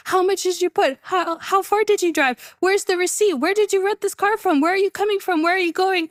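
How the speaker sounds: high, run-on barrage of questions; retold rapid questioning. Mechanics quizzing a foreign driver, retold rapid questioning. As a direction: imitate men firing questions one after another. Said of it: "speaking to me really fast".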